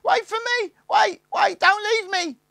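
very high, loud desperate wailing; terrified wail. A narrator's reading. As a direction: wail after them in panic. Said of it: "wailed the youngest".

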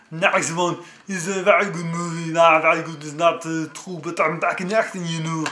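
booming hammed-up Terminator-style accent; bad Arnold Schwarzenegger impression. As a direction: ham up a thick Austrian accent like an action star. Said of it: "that's my very bad impression of Arnold Schwarzenegger".

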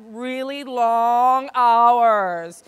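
REALLY LONG HOURS stretched out and loud; drawn-out mock warning. Real, not acted.

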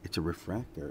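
soft, voiced mock whisper; retold whisper. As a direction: quietly pass on what he whispered. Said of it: "Josh just whispered".

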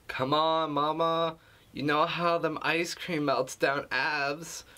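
squealing mock girlfriend; mock girly friend voice. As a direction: parody a gushing girlfriend texting. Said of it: "is this really how women talk over the phone".

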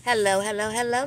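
normal talking voice

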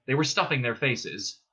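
ordinary delivery

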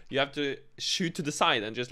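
ordinary delivery

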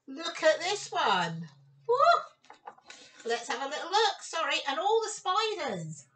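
plain reading voice

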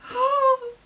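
loud, high gasp; excited gasp. Real, not acted. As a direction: gasp in delighted shock. Gasps at the album reveal.

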